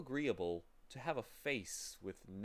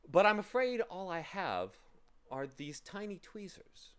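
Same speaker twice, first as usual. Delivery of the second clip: lightly voiced polite gentleman, higher pitched; pleasant man, apologetic; 7 semitones higher, 6 dB louder than usual. Pleasant man handing Milo a tool, mildly apologetic. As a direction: start loud and high, then ease off.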